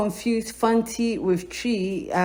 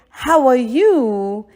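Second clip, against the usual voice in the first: slow, stretched "How are you?"; slow and clear; 3 dB louder, much slower than usual. Shown as a demonstration. Teacher recaps the phrase, slowly. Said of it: "how to say".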